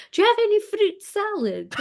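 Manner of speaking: very high, dainty little voice; tiny polite voice. Retold or imitated. Her asking truckers at a service station, tiny and high. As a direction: squeak it out like a small girl among truckers. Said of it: "me going".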